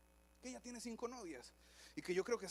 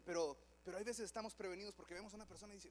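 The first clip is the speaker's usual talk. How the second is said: ordinary delivery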